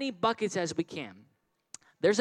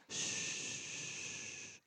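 shushing; 15 dB quieter than usual. Real, not acted. Quieting the children.

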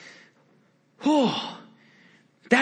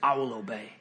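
low, much softer surrender; quiet vow; 10 semitones lower, 11 dB quieter, faster than usual. Retold or imitated. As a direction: murmur it as a humble vow. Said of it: "he says".

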